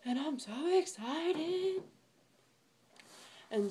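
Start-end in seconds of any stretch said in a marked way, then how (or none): 0.1-1.8 excited. Real, not acted. Gushing over hoodie.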